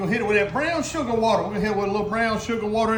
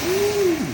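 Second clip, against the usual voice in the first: long, high-pitched ooh; savoring whoop of delight; appreciative ooh; 13 semitones higher, 4 dB louder than usual. Real, not acted. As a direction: let out a drawn-out high "ooh". Relishing the food on camera.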